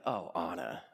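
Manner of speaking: low, soft mock-villain voice; scornful betrayer. Hans backs away from Anna, low mock-villain scorn. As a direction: drop deep and quiet, imitating a villain.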